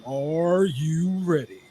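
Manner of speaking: ring announcer. Pretend. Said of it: "sound like a ring announcer".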